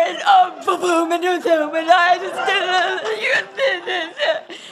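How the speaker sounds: high, loud hammed-up blubbering; mock meltdown. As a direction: carry the hysterics on at full volume. Acting out her meltdown.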